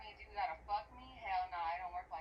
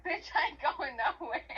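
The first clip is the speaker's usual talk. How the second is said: higher, louder mock defiance with a laugh; retold defiant outburst; 5 semitones higher, 6 dB louder than usual. Retelling being told to leave, defiant mock outburst. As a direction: refuse indignantly, half laughing. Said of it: "I fucking lost it".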